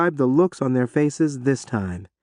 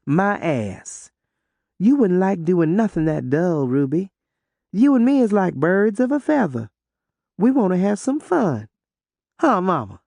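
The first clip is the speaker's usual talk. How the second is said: put-on sassy girl; 5 semitones higher than usual. A narrator's reading. Othello teases Ruby.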